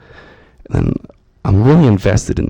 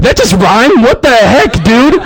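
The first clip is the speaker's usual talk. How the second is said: high, louder, startled outburst; shocked disbelief; 11 semitones higher, 3 dB louder than usual. Retold or imitated. Newcomer stunned that a church prayer rhymed, high shocked outburst. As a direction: react like you can't believe it rhymed.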